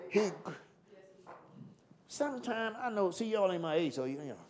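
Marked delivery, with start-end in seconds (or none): none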